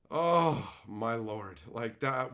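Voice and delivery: ordinary delivery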